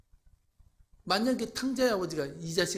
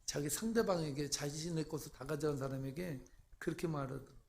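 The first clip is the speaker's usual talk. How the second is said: ordinary talk, nothing marked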